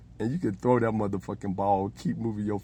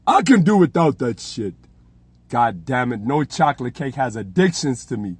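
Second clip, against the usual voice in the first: louder, indignant comeback; mock Trump outburst; 2 semitones higher, 9 dB louder than usual. Trump snapping at his aides, louder. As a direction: bark it back as a spoiled president. Trump voiced refusing, fed up.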